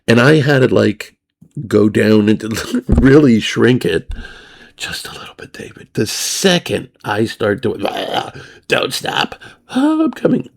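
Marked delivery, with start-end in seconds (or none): 4.8-5.9 a begging whisper, barely voiced; whispered plea. Woman whispering to David, begging for more. 7.8-10.5 whispered moan sliding to a high squeal; mock moaning. Teller imitating the woman's moaning, hammed-up.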